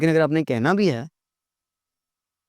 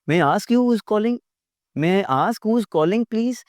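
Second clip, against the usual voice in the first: slow, higher courteous ask; polite phone question; 7 semitones higher, much slower than usual. Shown as a demonstration. Teacher modelling polite phone manners, slow. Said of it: "ना".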